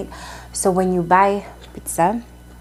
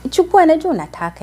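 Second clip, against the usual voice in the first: higher, louder coaxing push; pushy stranger; 10 semitones higher, 6 dB louder than usual. Retold or imitated. Man on the matatu urging her to take biscuits, pushy and coaxing. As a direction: press the biscuits on her, insisting. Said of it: "And then he's like".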